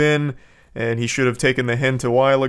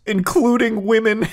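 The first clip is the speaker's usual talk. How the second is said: higher, sarcastic lift on including women; mock emphasis; 8 semitones higher than usual. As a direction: sneer at the afterthought. Mocking his apology text.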